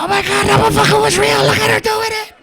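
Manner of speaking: high, loud, amazed yelling; amazed shout. Retold or imitated. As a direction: yell it out, delighted and shocked. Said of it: "you'll be like".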